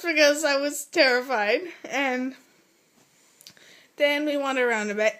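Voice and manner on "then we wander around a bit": ordinary delivery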